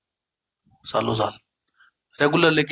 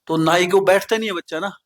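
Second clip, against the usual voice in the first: higher, louder preaching push; fervent preaching; 5 semitones higher, 4 dB louder than usual. Acted. Preacher urging the gathered devotees, fervent and raised. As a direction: drive the point home to the congregation.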